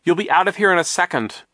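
high, loud promise; urgent reassurance. A narrator's reading. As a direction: insist it in the midst of a struggle. Klaus reassures during the struggle.

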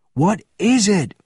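loud, high demand; roared question. A narrator's reading. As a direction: roar the question urgently. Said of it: "roared Benjamin".

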